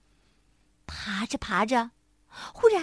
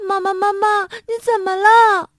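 high, loud, panicked crying call; a little monkey wailing for his fallen mother; crying out in fright; 9 semitones higher, 9 dB louder than usual. A narrator's reading. Little monkey calls to his fallen mother, loud frightened crying. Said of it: "帕帕吓得哇的哭了出来".

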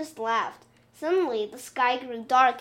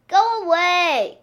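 sulky call; 6 semitones higher, 9 dB louder, slower than usual. Acted. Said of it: "called the wind".

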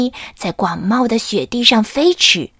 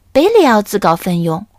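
ordinary delivery

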